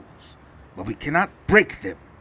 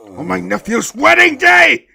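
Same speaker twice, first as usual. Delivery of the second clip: higher, louder outraged yell; furious shout; 12 semitones higher, 14 dB louder than usual. Acted. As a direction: blast it out in indignant rage. Mob boss furious about intrusion.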